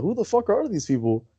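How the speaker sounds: retold bewilderment at uninvited guests; incredulous. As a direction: pitch it up, as if baffled. Baffled host retells confronting strangers.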